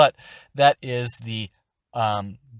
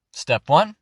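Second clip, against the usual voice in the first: ordinary talk, nothing marked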